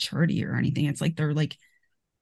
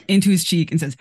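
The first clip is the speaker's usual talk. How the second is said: normal talking voice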